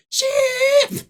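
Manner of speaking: very high, loud imitated cricket chirp; piercing cartoon squeak of a caught cricket; cricket squeal. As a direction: shriek out one shrill chirp. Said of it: "you hear the cricket go".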